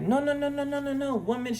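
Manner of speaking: high insistent no no no; refuse, hurrying her along; firm refusal. Retold or imitated. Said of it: "she was like".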